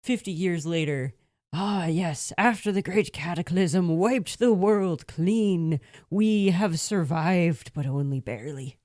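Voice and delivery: slow, loud, solemn storyteller; grand narrator voice. Pretend. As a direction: proclaim it like an ancient chronicle. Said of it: "it would be more like".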